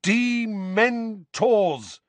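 Dementors in high, drawn-out separate syllables; slow and clear. A narrator's reading. Boy repeating Dementors for his uncle, slow and clear. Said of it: "slowly and clearly".

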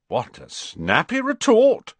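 high, loud mock admiration. A narrator's reading. Fred mocking Ron's comeback, sarcastic. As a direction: pretend to be impressed, pitched right up.

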